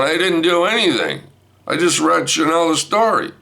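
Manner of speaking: high, insistent denial; defensive protest. Retold or imitated. Grandpa answering wife's accusation, high defensive protest. Acted quarrel, defensive reply.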